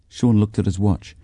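ordinary delivery, nothing marked

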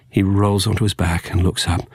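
ordinary delivery, nothing marked

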